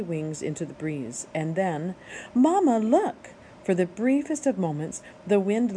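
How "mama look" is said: high, loud little cry for mama; gosling shouting look; excited call. Acted. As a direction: call out thrilled to mother. Gosling calls to mother.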